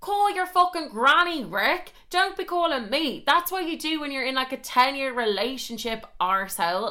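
high, indignant retold rant; heated comic tirade, hitting key words hard. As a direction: tell him off with mounting outrage. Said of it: "Oh, we're getting heated".

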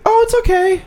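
put-on squeaky little kid voice; high kid voice. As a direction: pitch way up like a small boy. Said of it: "It's like".

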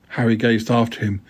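ordinary delivery

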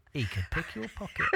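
a light Liverpool accent, mock; lightly put-on Scouse voice, teasing. Mocking a Scouser with a light Scouse accent. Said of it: "That's a Scouser talking".

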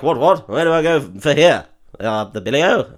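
mock upper-class bluster; a hint of a fussy toff; posh what-what voice. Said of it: "so he's like".

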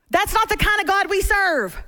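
usual speaking voice

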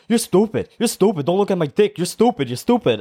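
high, repetitive mock Puerto Rican taunt; Puerto Rican accent. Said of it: "I'm gonna do an accent".

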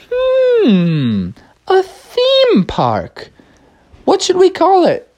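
high, slow scheming zookeeper voice; villain voice. Acted. Zookeeper plotting his theme park, high and slow. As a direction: muse over the name like a sly villain. Zoo Creeper Carl pondering aloud.

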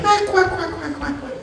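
very high quacking cackle fading to nothing; high duck-like cackle, trailing off; old lady's quacking laugh. Retold or imitated. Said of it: "se empezó a reír".

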